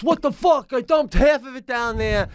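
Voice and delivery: high, dismayed voice of the wife; imitating wife. Imitating his wife cursing after spilling the pasta. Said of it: "she goes".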